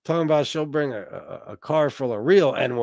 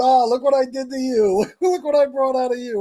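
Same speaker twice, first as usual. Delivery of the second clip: higher, laughing through the gloat; giggling mock triumph, pitched up; gleeful gloating taunt; 10 semitones higher, 5 dB louder than usual. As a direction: rub it in with a laugh. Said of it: "And I was laughing and smiling".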